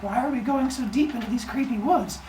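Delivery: high, put-on questioning girl voice; Mincinin. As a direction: imitate a naive girl asking, pitched well up. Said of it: "And she goes like".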